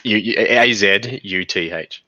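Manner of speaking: normal talking voice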